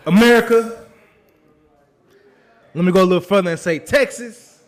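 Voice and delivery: booming formal address to the nation; loud proclamation. Acted. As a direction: announce it like a grand speech. Grand show-opening address.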